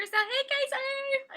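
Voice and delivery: high, loud, slow greeting call. Real, not acted. Greeting the new project manager on the live stream with a loud, high call. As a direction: sing out a cheery hello to her.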